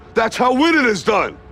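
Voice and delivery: high, forceful fatherly exhortation; fired-up tough love at full voice; impassioned pep talk. Acted. Father's fierce pep talk to son.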